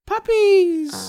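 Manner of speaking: high squeal, very loud; delighted puppy squeal; squealing puppies. Real, not acted. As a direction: shriek it with delight. Excited squeal about puppies.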